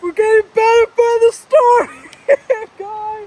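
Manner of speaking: laughing while talking. Real, not acted. Laughing through mock report.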